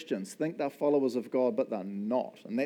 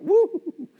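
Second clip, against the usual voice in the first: whoop; 7 dB louder than usual. Pretend. Imagined joy at fruitful vine.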